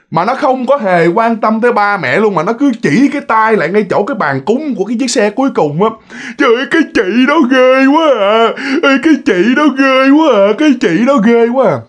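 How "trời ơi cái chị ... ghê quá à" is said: put-on high, wailing little-child voice; imitated child crying out in fear; crying toddler. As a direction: whine it like a frightened toddler. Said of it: "cái đứa nhỏ này nó mới khóc".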